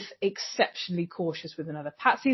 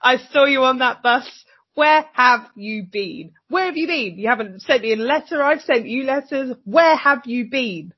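mock-furious, higher and louder, slowing on each "where"; angry rant; 5 semitones higher, 4 dB louder than usual. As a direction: vent two days of hurt at her. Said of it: "'Cause she's like".

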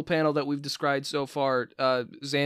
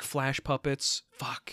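soft, slow breathy whisper; excited whisper about making Flash puppets; whispered idea; 8 dB quieter, much slower than usual. Real, not acted. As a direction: hush it like a secret plan. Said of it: "excited hushed idea".

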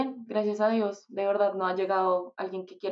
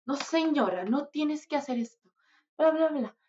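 higher-pitched imitation of the manager scolding; mock scolding; 5 semitones higher than usual. Manager scolding her to grow up, imitated higher. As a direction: play the manager telling her off, half in jest. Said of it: "y me regaña".

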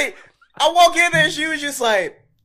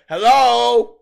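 higher, louder call; 7 semitones higher, 11 dB louder than usual. Retold or imitated. Calling out loud as she enters the unit. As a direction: call into the empty room. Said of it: "I'm screaming out loud".

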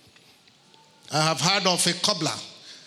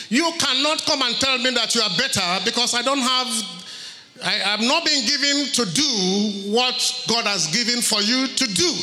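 preaching at full force; 4 semitones higher than usual. Acted.

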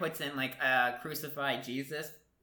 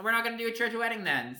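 brisk brush-off; 8 semitones higher, faster than usual. Retold or imitated. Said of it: "He's like".

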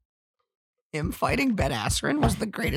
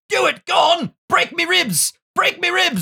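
high mock British boxer; 13 semitones higher than usual. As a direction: ham up a cocky British fighter daring a hit. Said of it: "He's like".

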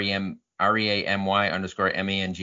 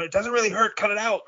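higher, mocking brush-off voice; dismissive parent; 11 semitones higher than usual. Parent telling a hurt kid to stop fussing, mocking high voice. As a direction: wave off the kid's pain. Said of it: "you know, you know".

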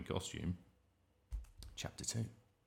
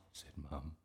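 ordinary delivery, nothing marked

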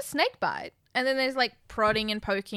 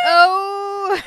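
mock gasp; 8 semitones higher, 8 dB louder than usual. Mock reaction to taunt.

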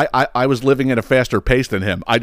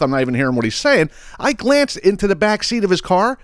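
normal talking voice

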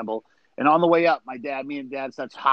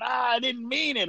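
much higher, pleading mock whine; dad's whiny apology; 9 semitones higher, 3 dB quieter than usual. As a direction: play the guilty dad begging forgiveness. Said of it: "he kept going".

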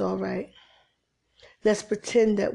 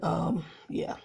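usual speaking voice

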